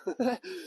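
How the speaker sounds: short amused chuckle. Real, not acted. Guide amused by his American impression, short chuckle. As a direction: chuckle briefly at your own joke. Laughs at own accent joke.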